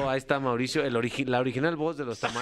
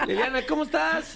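excited greeting; 10 semitones higher than usual. Real, not acted.

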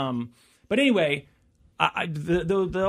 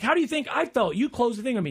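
indignant customer; 6 semitones higher than usual. Retold or imitated. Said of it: "she's like".

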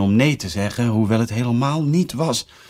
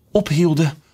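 usual speaking voice

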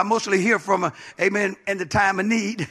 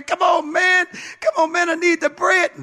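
desperate begging neighbor; 6 semitones higher than usual. Acted. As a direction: hammer the door and beg for bread.

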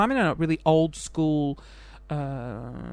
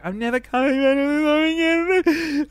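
high, loud, sob-broken wail; mock ugly-cry wail; bawling quote; 10 semitones higher, 4 dB louder than usual. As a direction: voice cracking into an ugly cry as the words come out. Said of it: "and then I just bawled".

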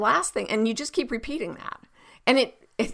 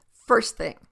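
ordinary talk, nothing marked

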